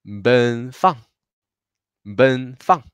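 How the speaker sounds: "Ben" held high, "Fan" falling short; first plus fourth tone. Shown as a demonstration. Teacher joins both syllables, high "Ben", falling "Fan". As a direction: say "Ben Fan" as level high, then a sharp drop. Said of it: "Y juntos va a ser".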